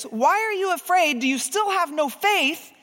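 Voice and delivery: the harsh Jesus she once imagined, scolding. Retold or imitated. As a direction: scold them like a harsh judge. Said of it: "I've got him with a harsh voice there".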